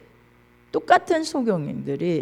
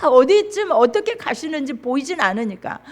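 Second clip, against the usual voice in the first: usual speaking voice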